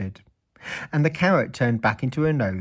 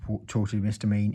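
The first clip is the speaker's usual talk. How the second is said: usual speaking voice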